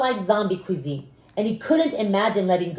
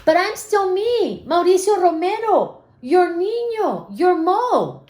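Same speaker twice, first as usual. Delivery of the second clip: put-on higher, earnest kid insisting; pleading child voice; 8 semitones higher than usual. Mo telling his parents he is different, earnest. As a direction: stand up for yourself like a small boy. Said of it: "Mo pleads with parents".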